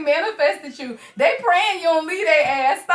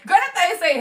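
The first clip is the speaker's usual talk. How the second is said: ordinary talk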